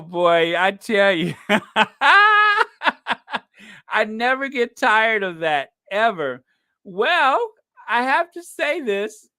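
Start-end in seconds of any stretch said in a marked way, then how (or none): 1.4-3.4 high, loud burst of laughter; big delighted laugh. Real, not acted. Host cracks up at a viewer's joke, loud high laugh.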